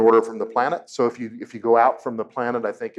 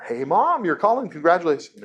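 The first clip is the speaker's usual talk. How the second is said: cheery answer; 6 semitones higher than usual. Retold or imitated. Son answering mother's call.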